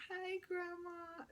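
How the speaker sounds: put-on high, soft, slow little-girl voice; tiny voice like a child; small child voice. Granddaughter calling grandma, in a high, soft, childlike voice.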